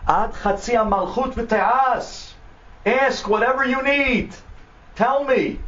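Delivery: high, slow, grand promise; expansive offer. Acted. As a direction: offer the world to an old friend. President grandly offers friend anything.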